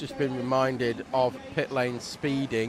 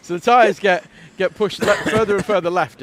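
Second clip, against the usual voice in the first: high, loud, still laughing; 7 semitones higher, 10 dB louder than usual. Real, not acted. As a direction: talk through leftover laughter. Laughing after the massage joke.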